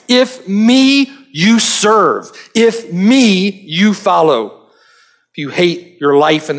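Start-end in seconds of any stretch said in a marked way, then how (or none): 0.1-4.6 slow "If me you serve, if me you follow" with "me" punched higher; "me" as the center of each phrase, drawn out; stress on me. Shown as a demonstration. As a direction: hammer each "me", slowly. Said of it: "It's an emphasis on Christ".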